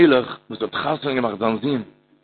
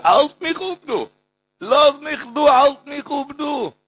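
pleading cry; 7 semitones higher, slower than usual. Acted. The son begs his father to hold him, a high pleading cry.

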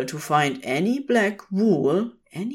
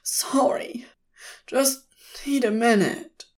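high, whispery tearful apology; understated acted sob, pitched up; sobbed; 6 semitones higher than usual. A narrator's reading. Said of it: "Crowley sobbed".

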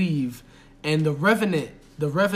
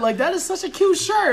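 much higher, gushing mock voice; mock sweet compliment; 12 semitones higher than usual. Friend teasing her fake niceness in the car, high gushing mock voice. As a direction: gush over the shirt like a fake friend.

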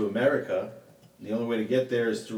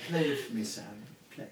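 put-on glamorous old Hollywood star; 6 dB quieter than usual. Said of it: "the mid-Atlantic".

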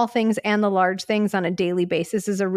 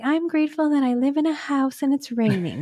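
mock kid voice; 5 semitones higher than usual. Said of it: "Not just like".

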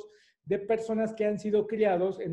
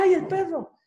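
put-on high, loud child's yelp of fright; child frightened by a dog, crying out; startled cry; 10 semitones higher, 6 dB louder than usual. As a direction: jump at the dog.

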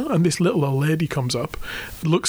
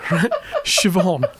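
normal talking voice

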